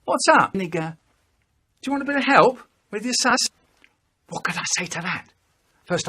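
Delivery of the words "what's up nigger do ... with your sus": higher, louder fast taunt; brother's voice. Retold or imitated. Brother offering help, higher, louder and fast. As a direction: rattle it off mocking the brother. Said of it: "he says".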